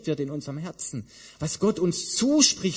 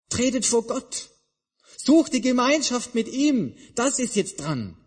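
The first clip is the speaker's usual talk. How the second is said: higher and louder call to God; rising appeal; 9 semitones higher, 4 dB louder than usual. Retold or imitated. Jesus urging the disciples to seek God. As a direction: lift it into a stirring appeal. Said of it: "Darum sagt Jesus".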